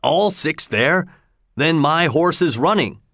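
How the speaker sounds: the colonel can't believe his ears; great surprise. Acted. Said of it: "cried the colonel with great surprise".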